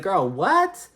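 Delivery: put-on high-pitched "girl, what?" disbelief; incredulous reaction. Podcaster recalling her reaction to the brick story, incredulous.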